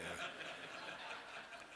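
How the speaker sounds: soft airy disbelieving laugh; breathy laugh. Real, not acted. Pastor laughing at the jet's price, soft and airy.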